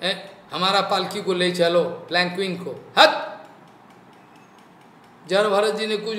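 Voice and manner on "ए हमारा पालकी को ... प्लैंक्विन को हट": imperious orders to palanquin bearers, with sudden shouts; acted royal reprimand in bursts; scolding King Rahugana. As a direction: bark at the bearers, then order them on. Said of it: "रुहुगन कितना बोलना चाहता".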